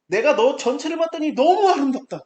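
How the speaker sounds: high, loud exclamation of wonder; marvelling loudly at the whole camp; delighted quote. Retold or imitated. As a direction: proclaim it with awe, voice lifted high. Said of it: "이렇게 말한 거예요".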